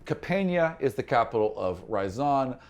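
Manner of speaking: ordinary delivery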